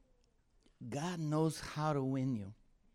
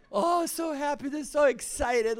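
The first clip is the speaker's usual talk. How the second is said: mock cheerful; 11 semitones higher, 6 dB louder than usual. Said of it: "they're like".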